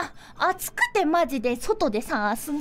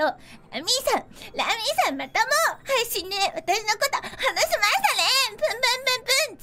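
mock pouting anger; 8 semitones higher than usual. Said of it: "お-、怒るのよ".

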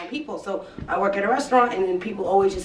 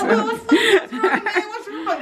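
high, laughing "what's wrong, man"; pitched-up mock worry at a sad-sounding friend; mock concern; 12 semitones higher, slower than usual. To a Colombian who sounds sad, asking what's wrong in a high voice. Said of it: "You're like".

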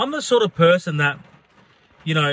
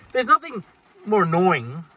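normal talking voice

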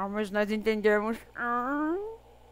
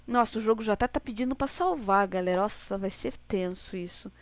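ordinary delivery

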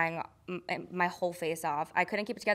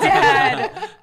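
laughing exasperation; 12 semitones higher, 12 dB louder than usual. Retold or imitated.

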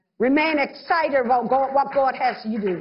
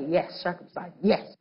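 dismissive brush-off; 9 semitones lower, 5 dB quieter than usual. Retold or imitated. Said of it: "They're like".

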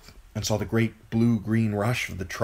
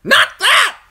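piercing, very loud bird shriek; shrieking crow; 15 dB louder than usual. A narrator's reading. Crow driving a memory from Bran, shrieking. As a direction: screech it in alarm. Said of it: "it shrieked at him".

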